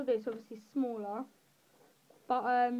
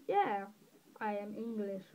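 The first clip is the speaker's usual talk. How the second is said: ordinary delivery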